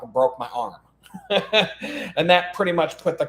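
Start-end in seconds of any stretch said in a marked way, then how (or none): 1.1-2.1 rueful laugh. Real, not acted. Laughing at his own crash.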